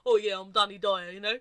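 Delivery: put-on loud, botched Cockney accent veering Australian; Danny Dyer impression. Co-host channels a celebrity, loud bad Cockney accent. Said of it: "I can channel Danny Dyer now".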